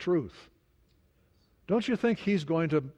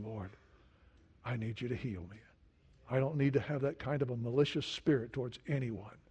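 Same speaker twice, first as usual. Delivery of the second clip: hushed, softer prayer to the Lord; quiet prayer; 4 semitones lower, 6 dB quieter than usual. Acted. Preacher voicing a humble prayer for healing, hushed. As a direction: pray it humbly, dropping the voice.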